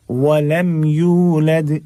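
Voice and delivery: यूलद with a moderately held "yoo"; measured long yu. Shown as a demonstration. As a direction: chant यूलद, not overstretched. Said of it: "बहुत ज़्यादा नहीं खींचना है".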